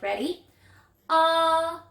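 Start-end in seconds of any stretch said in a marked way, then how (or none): 1.1-1.8 'Ah' held long on a high level note; short O sound. Shown as a demonstration. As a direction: sustain 'Ah' as in octopus, higher than usual. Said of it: "make the "ah" sound".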